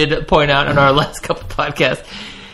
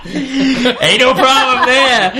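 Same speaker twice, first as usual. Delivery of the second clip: laughing drawl; 11 semitones higher, much slower than usual. Real, not acted. Laughing joking reassurance.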